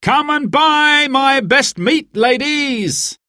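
high, slow hawker's call; market cry. Acted. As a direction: sing out to draw buyers. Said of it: "he cried".

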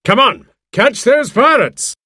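high, urgent rallying shout; crying out. A narrator's reading. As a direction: send everyone after the enemy. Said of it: "cried out Doctor Livesey".

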